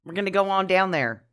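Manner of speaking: put-on half-hearted Southern-style y'all accent; badly faked accent. Podcaster trying an Aussie term, badly faked Southern accent. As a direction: attempt a folksy Southern accent. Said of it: "equipped to talk about accents".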